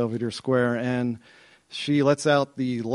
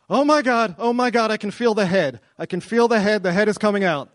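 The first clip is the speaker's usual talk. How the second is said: high, loud retold alarm; panicked wife; 7 semitones higher, 4 dB louder than usual. Wife in labour warning husband in the car, acted panic. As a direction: cry out in mock panic, short of a scream. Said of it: "she lets out this blood-curdling scream".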